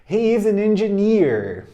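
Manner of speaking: slow model. Shown as a demonstration. Teacher models the line slowly and loudly. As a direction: project it slowly to the class. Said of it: "Repeat after me".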